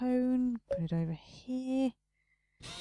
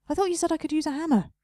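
indignant complaint; 7 semitones higher, 5 dB louder, faster than usual. Real, not acted. Complaining about the game's broken promise.

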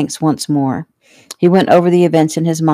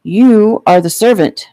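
slow, higher-pitched stern decree; stern child; 4 semitones higher, 4 dB louder than usual. A narrator's reading. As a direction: play the bossy little master. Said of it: "Joey said sternly".

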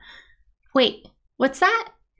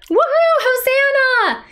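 celebrating crowd; 12 semitones higher, 5 dB louder than usual. Pretend. Leader waving palms with children, cheering high. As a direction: whoop and hail with delight. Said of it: "Wave your palm branches with me".

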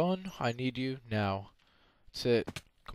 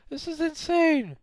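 shocked yell; 4 dB louder than usual. Real, not acted. Shocked reaction while playing.

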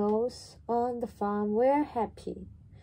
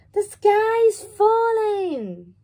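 high, loud frightened chick voice; panicked cry; 13 semitones higher, 9 dB louder than usual. Acted. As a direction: shriek the news in terror. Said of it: "cried Chicken Licken".

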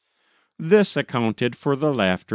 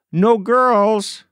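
high, loud teasing yell; shouted protest; 11 semitones higher, 5 dB louder than usual. Acted. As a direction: shout it out like a rowdy boy. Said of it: "cried Doak".

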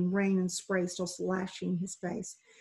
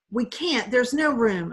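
louder, firm refusal shouted down; yelling; 4 semitones higher, 6 dB louder, slower than usual. Acted. Man on the dinghy refuses the drowning boy, loud yell. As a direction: shout the refusal over the waves. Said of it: "a man inside the dinghy yelled".

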